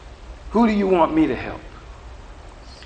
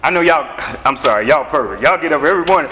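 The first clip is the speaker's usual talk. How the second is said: ordinary talk, nothing marked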